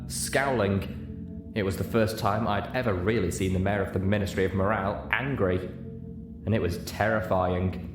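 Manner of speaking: ordinary delivery, nothing marked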